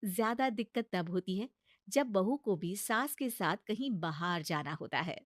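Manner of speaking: normal talking voice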